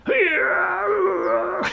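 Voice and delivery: high, loud strained cheer; hammed-up idle character yelling; mock cry. Gamer mocking a character standing there, high strained cry. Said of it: "stood there going".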